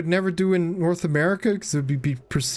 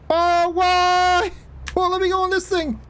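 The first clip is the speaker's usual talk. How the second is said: much higher, rapid string of frantic nos; panicked no-no-no. Real, not acted. Streamer watching his character plunge, real high-pitched panic. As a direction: squeal your way down in real panic.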